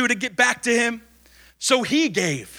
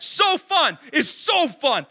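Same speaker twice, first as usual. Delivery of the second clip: mock thrill; 7 semitones higher, slower than usual. Preacher mimics thrill-ride joy.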